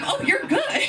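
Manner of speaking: very high squeak of praise; impressed customer. Retold or imitated. Customer at the dance-off, high impressed squeal. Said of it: "then he was like".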